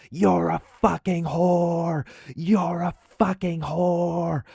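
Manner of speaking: high, slow, drawn-out mock scream; retold screaming. Neighbor screaming at the woman passenger, enraged. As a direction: stretch each insult into a high acted screech. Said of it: "Troy just starts screaming at her".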